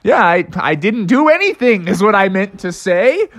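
high, relieved frog voice; high squeaky relieved frog. Acted. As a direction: breathe out in relief, then cover up. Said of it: "Froggy's like".

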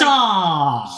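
loud, stretched exclamation; drawn-out mock objection; mock protest. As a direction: playfully cry out in disbelief. Mock protest at retold remark.

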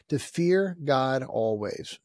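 drawn out, each word weighed; slow emphatic sermon line; slow and deliberate. Real, not acted. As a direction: lay it down slowly. Preacher repeats a point.

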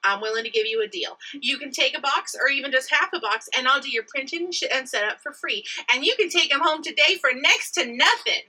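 closing the pen sale with flirty charm; flirty hard sell. Shown as a demonstration. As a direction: push the deal with a wink. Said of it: "I'm gonna do it for you now".

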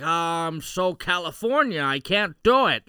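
loud, hammed-up imitation of failing at an accent; mock struggling Californian. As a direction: exaggerate the helpless West Coast actor. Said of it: "he's still, he's like".

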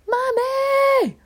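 put-on high wailing kid scream of Mommy; child's scream. Toddler in the back seat screaming for his mom, high wail. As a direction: shriek Mommy like a panicked toddler. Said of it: "screams at the top of his lungs".

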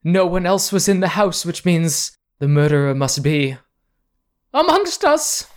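hammed-up grief, rising; distraught lady. Noblewoman grieves for her murdered father to the cousins, high loud wail. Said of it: "Constance takes your arm and says".

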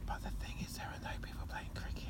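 voiceless whisper throughout. Real, not acted. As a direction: say it as a secret, breathy. Whispered aside.

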